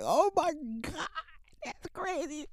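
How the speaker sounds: very high, squealed helpless laughter; crying with laughter. Retold or imitated. Son reacting to father's embarrassing story, laughing till crying. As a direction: squeak the words through laughter. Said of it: "I just started crying".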